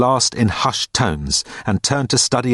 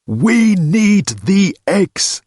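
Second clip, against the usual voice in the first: savoured punchline; 10 semitones higher, 4 dB louder, much slower than usual. Acted.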